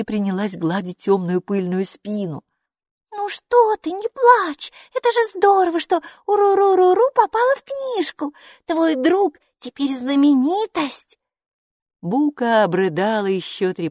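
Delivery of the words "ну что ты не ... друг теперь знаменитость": very high, loud little character voice; high-pitched consoler. Acted. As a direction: console a crying friend in a squeaky high voice. Майки consoles the sobbing creature.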